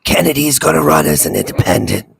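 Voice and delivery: low, strained politician's croak; labored, halting impression, pitched well down; Kennedy. Co-host imitates the candidate's real voice, low and strained. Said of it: "That's exactly how he sounds".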